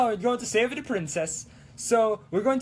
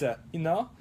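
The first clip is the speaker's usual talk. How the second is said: usual speaking voice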